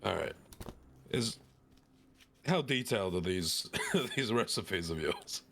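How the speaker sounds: ordinary delivery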